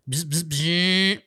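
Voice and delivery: slow buzzing engine noise; drawn-out mouth-made buzzing; imitated motor buzz. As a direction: mimic a little bike motor. Imitates the mini bike motor.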